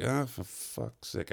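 slow, hushed mock grumble; weary exasperation. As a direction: drag it out under your breath. Private groan at the chatty gardener.

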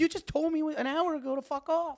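high-pitched mock indignation; indignant protest. Him objecting to friend's sudden reversal, high retold indignation. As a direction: pitch it way up, retelling disbelief.

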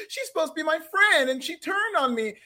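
a hint of Roxanne's whiny complaint; mock whiny. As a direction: whine it like the hurt friend. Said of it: "she has kind of a whiny voice too".

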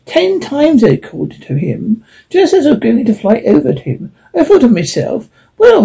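chuckling through the words; a magpie laughing while telling her tale. Acted. Bird laughing at its own story. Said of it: "the magpie said with a laugh".

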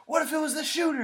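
high, alarmed retold shout; retold panicked shout; scolding shout. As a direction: yell it at him in alarm. Teacher scolding.

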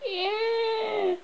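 long high shriek. A narrator's reading.

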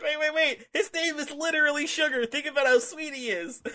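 high-pitched pleading pitch; mock panicked bargaining. Gene sells the zombies on Sugar, mock-panicked pitch. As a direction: coax the attackers elsewhere, voice pushed up high. Acted plea to advancing zombies.

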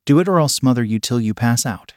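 ordinary delivery, nothing marked

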